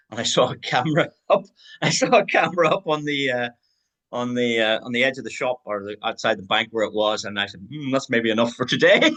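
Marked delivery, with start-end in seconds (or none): 0.1-3.3 higher voice breaking into a laugh; amused, laughing. Real, not acted. Presenter confessing he spotted a camera, laughing and higher. Amused retelling of embarrassing cash withdrawals. 7.7-9.1 higher mock-sheepish thought, tipping into laughter; laughing self-quote. Presenter recalling stopping at the cash machine, higher and laughing.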